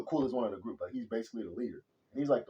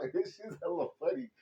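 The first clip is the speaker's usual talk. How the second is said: much higher, laughing through words. Retold or imitated. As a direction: giggle it out, giddy. Giddy kid after party.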